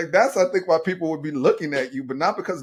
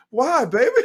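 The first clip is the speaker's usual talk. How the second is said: high, loud mock-worried dad; concerned dad; 12 semitones higher, 7 dB louder than usual. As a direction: ask it like a worried father to his daughter. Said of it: "I'm like".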